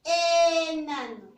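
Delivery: slow, loud teacher model of enano; slow model word. Shown as a demonstration. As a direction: stretch out enano for the class. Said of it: "¿Inicia con la vocal E".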